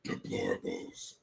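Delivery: very low, slow growled word; mock demon Hillary. Vlogger mocks Hillary saying it in a low growl. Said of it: "that's how I hear it".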